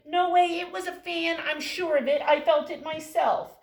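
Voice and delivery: loud, high scoffing insistence; loud sure little mouse; arguing mouse. Acted. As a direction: shout no way and stand firm. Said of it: "orange mouse come up and say".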